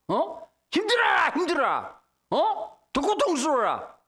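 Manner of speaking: 높게 빈정대며 고통을 주는 하나님 흉내; 심술궂은 하나님을 비꼬듯 연기. Retold or imitated. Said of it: "막 화가 나시고". (화난 하나님을 과장해 흉내 내는 목소리)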